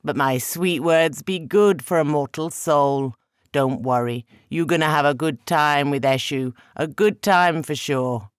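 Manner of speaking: coaxing trickster god. Acted. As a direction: sweet-talk the mortal, teasing. Eshu coaxing with sweet words.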